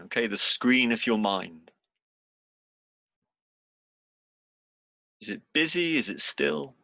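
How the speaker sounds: unhurried meditation guide, long pauses between phrases; guided meditation. Real, not acted. Teacher guiding seated students into body and breath, guided meditation. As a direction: lead them gently through it, leaving space after each instruction.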